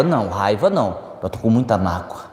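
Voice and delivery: mock the polite excuse, quietly and low; a touch of self-deceiving sweetness; mock prim excuse. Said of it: "Olha que bonito que é".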